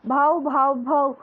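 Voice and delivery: put-on a baby's playful puppy-like bow-wow; baby barking like a dog. A narrator's reading. Baby son answers his parents with a bark instead of mama, puppy bark. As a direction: yap it out like a tiny puppy. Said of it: "کتے کی طرح بھاؤ کیا".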